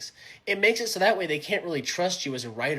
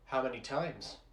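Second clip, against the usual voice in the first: hushed sympathy; 3 semitones lower, 16 dB quieter, slower than usual. Acted. Hearing the friend's sad story.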